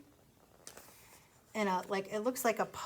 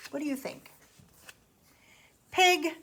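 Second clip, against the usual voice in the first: ordinary delivery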